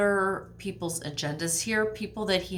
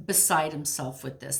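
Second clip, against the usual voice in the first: ordinary talk, nothing marked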